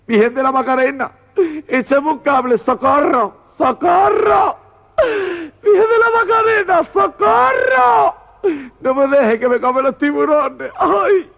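mock panic building to very high shrieks; squealing, wailing comic cries for help; scared to death. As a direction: scream to heaven for rescue, hammed up. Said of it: "La mariquita muerta a miedo".

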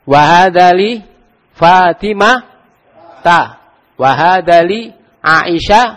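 slow, drawn-out Arabic recitation; slow teacher demo; slow model reading. Shown as a demonstration. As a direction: recite slowly for the class to repeat. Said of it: "Tambah lagi".

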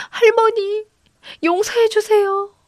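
very high, drawn-out wail; bursting into tears. A narrator's reading. Granddaughter finds grandmother dead, bursting into tears. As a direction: plead tearfully in a high voice. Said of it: "그만 울음보를 터뜨렸습니다".

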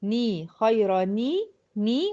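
slow, isolated "ني" as a clear ni; drawn-out teaching repeat of "ني" with i after n; demonstrating kasra. Shown as a demonstration. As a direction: break out "خيرا ني" as khayra-ni. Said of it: "هكسر الساكن الأول".

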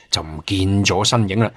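ordinary delivery, nothing marked

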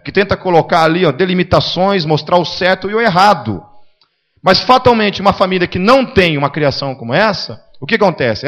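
loud, driving sermon voice; forceful preaching. Acted. Preacher pressing the congregation, loud and driving. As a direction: hammer the point home to the church.

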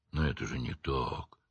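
low, hushed, shaken objection; shaken protest. A narrator's reading. Simple-hearted Hagrid objecting to his friends, shaken and hushed. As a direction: protest softly, taken aback. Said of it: "Простодушный Хагрид был потрясён".

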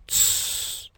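soft, long "tsss"; hissed shush. A narrator's reading. Fox silences the bear, hissing a shush. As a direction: hush him with a sharp hiss. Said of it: "прошипела она".

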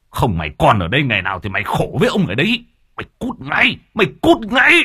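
screaming at his wife to get out; furious shout. Acted. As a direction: throw her out in blind fury.

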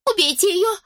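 high, panicked squeal; shrill cry of panic. Acted. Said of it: "взвизгнула Жасмин".

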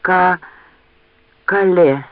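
halting, out of breath; breathless gasp. Acted. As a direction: gasp the word out in pieces. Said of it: "задыхаясь".

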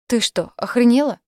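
high, loud furious demand; angry outburst. Acted. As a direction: snap at her in shock. Kicked man yells at her.

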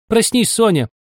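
high-pitched wake-up call; exclaiming. A narrator's reading. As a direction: call out sharply to rouse a sleeper. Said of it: "воскликнули оба".

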